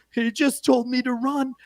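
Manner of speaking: put-on whiny lost kid. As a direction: whimper it like a rookie who got lost. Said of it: "the kid goes".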